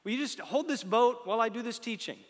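high, light voicing of a friendly Jesus; Jesus asking casually. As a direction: ask it like a casual favour. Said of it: "It's like".

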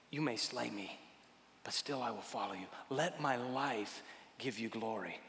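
soft, surrendered prayer voice; hushed prayer. Acted. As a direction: pray it softly, letting go. Quiet surrendered prayer quoted.

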